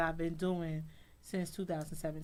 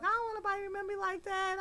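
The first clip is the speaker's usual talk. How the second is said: pleading; 13 semitones higher, much faster than usual. Retold or imitated. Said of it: "I was like".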